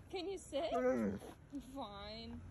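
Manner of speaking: ordinary delivery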